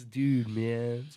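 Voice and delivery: lightly voiced stoner; lazy stoner drawl. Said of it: "I do have a good impression of it".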